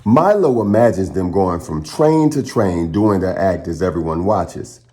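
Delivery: plain reading voice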